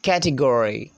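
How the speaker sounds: "category" with a strong American R; American-style "category", full ending. Shown as a demonstration. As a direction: stretch "-gory" in "category" and sound the R. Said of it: "they roll R sound".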